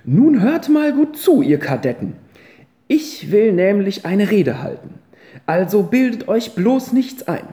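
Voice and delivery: put-on high, loud, firm old lady addressing the boys; grandmother's speech. Grandmother stands to lecture the boys, high and loud. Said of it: "stand auf und sagte".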